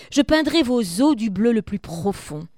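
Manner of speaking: solemn poetry read; poem reading. Acted. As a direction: let each line of the poem hang. Teacher reads the poem aloud.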